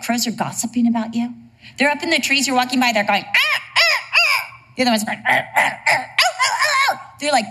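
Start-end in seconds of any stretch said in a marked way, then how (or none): 3.2-4.6 high mock crow cawing; crow caws. Preacher imitating crows gossiping, high cawing. 5.3-7.0 high squawking crow imitation with a laugh; crow caws. Preacher voicing the other crow, high laughing caws.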